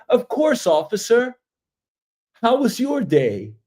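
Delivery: high, sugary polite voice to a cop; mock-sweet innocent driver; overly polite. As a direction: sweeten it up for the officer. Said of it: "not gonna be all prim and proper".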